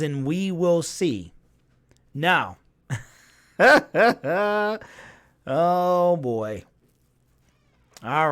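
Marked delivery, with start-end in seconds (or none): 3.6-4.8 sudden loud laugh; short real laugh out loud; burst of laughter. Real, not acted. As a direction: crack up at the chat. Laughing at chat comment.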